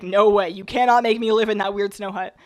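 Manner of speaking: usual speaking voice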